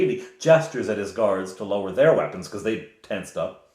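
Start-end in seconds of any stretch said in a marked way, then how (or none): none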